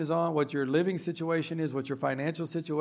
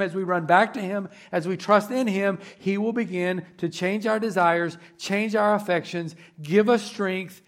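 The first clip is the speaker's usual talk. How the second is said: loud rising sermon climax, higher and louder; preaching at full force; 3 semitones higher, 6 dB louder than usual. Acted. Pastor urging the church to abide in Him, fervent. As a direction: build it up to a fervent, loud appeal.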